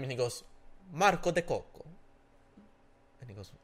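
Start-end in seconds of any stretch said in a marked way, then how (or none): 0.9-1.8 loud, high fake Italian name; mock Italian accent. The next undercover guy giving his name, hammed-up Italian accent. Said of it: "they're acting like Italian".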